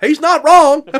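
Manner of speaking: high, loud cheering agreement; gleeful shout. Retold or imitated. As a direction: back him up with a loud whoop. Father backing his heckle.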